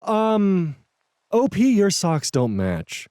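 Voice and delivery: high, drawn-out catty remark; mock mean girl, stretching the words. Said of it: "with a mean girl tone".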